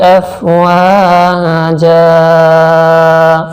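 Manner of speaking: slow melodic tajweed chant; solemn chanted Quranic verse; Quran recitation. Shown as a demonstration. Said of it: "مکمل آئے دیکھیے".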